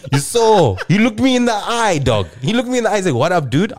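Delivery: shouting the story at friends, pitch climbing; worked-up ranting. Real, not acted. Comedian ranting to friends about the guitarist who snubbed him, loud and high.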